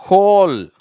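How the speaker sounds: ஹோல் high and loud with a clear h; teacher's ringing model word ஹோல்; H sound demo. Shown as a demonstration. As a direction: model HOLE, h sounded, w silent. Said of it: "இது ஹோல்னு சொல்லணும்".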